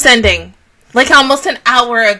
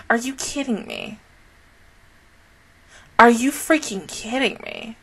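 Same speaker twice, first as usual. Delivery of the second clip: usual speaking voice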